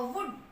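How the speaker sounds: वुड with a short उ; short vowel. Shown as a demonstration. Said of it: "ऊ short हम symbol जो है".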